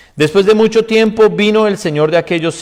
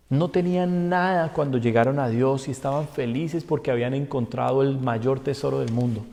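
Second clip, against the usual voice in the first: usual speaking voice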